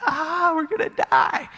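high-pitched acted wail; hammed-up doomed scream; panicked crowd. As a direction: cry out in mock terror. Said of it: "everybody's going".

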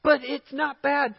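high-pitched mock protest; protesting objector. Congregant objecting to throwing things out, high-pitched protest.

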